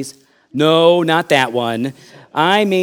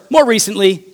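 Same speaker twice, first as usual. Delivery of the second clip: ordinary talk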